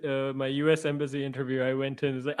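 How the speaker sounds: ordinary delivery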